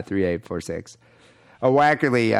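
normal talking voice

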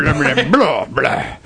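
put-on silly garbled English-accent blahs; mock English accent. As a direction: play about with a badly faked English accent. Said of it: "with an English accent".

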